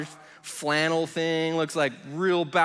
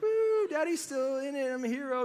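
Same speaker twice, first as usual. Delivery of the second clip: much higher, cheering mock hero boast; mock kids cheering; 10 semitones higher than usual. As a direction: imitate excited kids high-fiving. Kids cheering dad in truck.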